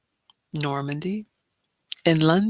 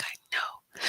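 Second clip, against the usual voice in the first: soft unvoiced whisper as her son; a little boy confessing in a whisper; whispered kid; 10 dB quieter than usual. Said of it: "He's like".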